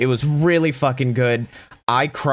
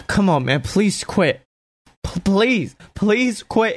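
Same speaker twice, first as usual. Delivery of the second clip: pleading; 7 semitones higher, slower than usual. Retold or imitated. Roman pleading over unconscious Jay.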